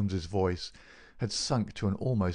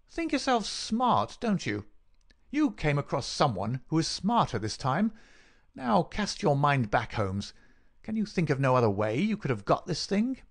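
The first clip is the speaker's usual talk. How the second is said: higher-pitched, gloating villain; taunting villain; 6 semitones higher than usual. Acted.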